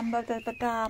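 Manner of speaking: usual speaking voice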